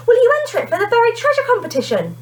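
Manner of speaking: high and fast, tumbling words; eager breathless plea. A narrator's reading. Kerry begs Mister Hart to enter the coin, eager and fast. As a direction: rush the question out, hoping hard. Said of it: "panted Kerry".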